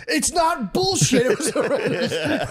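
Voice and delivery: high-pitched, drawn-out mock scream; retold scream. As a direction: imitate a TSA guy screaming in outrage. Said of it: "dude, he screamed it".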